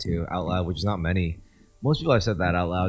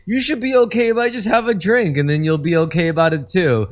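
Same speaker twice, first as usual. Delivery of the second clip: high, louder needling voice; egging a friend to drink along; mock pushy drinker; 9 semitones higher, 5 dB louder than usual. Mocking a drinker who pressures a friend. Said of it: "You're like".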